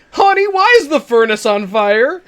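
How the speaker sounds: high, loud, slow call to a partner; mock alarmed call.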